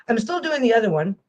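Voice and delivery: normal talking voice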